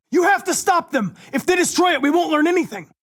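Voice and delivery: high, loud urgent order; yelling to stop the destruction; urgent shout. Acted. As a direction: shout to stop them in time. Urging others to stop.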